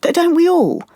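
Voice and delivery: high, agitated protest; defensive protest. Acted. Client challenged by therapist on caring how people see them, defensive and agitated. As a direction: push back, voice jumping up.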